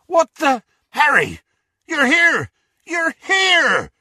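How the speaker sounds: loud, high-pitched amazed greeting; astonished shouting. A narrator's reading. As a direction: shout with overjoyed disbelief. Hagrid astonished to find Harry.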